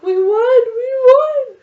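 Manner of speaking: shrieking at the top of her lungs; screaming with joy. Retold or imitated. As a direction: scream it like you just won. Sister screaming news in car.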